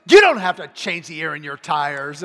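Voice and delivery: loud, indignant complaint; You don't have to change the air in your tires, thrown back loud and cross; friend's fiancee, annoyed at being teased, loud and angry; mad. Retold or imitated. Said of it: "she's mad".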